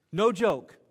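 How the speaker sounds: pressing the point home. Real, not acted. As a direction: insist it's true, loudly. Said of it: "emphatic repeat".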